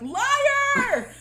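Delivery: high, loud hammed-up yell; accusing shout. Ma stabs baby Andy, accusing shout. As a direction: scream the accusation in mock fury. Acted Ma stabbing boy, accusing.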